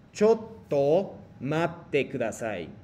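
very slow, every syllable laid out; drawn out so students can copy; slow model phrase. Shown as a demonstration. Teacher modelling for students to repeat, very slow. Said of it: "Me repiten, por favor".

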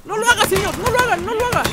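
very high-pitched frantic protest; panicked pleading. Real, not acted. Player begging the señor not to do it, high panicked pleading. Panicking as game character acts.

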